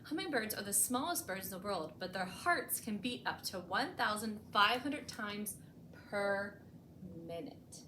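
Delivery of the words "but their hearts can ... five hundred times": plain reading voice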